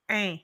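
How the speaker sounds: "un" sounded from the nose; nasal vowel. Shown as a demonstration. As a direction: let "un" resonate through the nose. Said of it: "from the nose".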